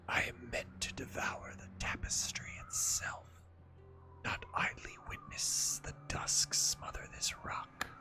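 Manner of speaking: slow whispering serpent voice. Acted. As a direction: breathe it out like an ancient serpent. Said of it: "You like my serpent voice?".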